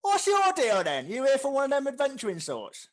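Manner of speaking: very high, booming interrogation; loud orc innkeeper. Acted. Orc barkeep sizes up an adventurer, loud and high. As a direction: shout it like a rough barkeep.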